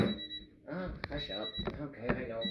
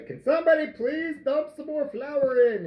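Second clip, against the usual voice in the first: high, raised-voice call for help; acted exasperated plea; 10 semitones higher than usual. Retells his plea to daughter.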